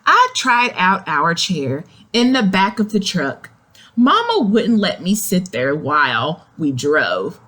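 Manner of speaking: ordinary delivery, nothing marked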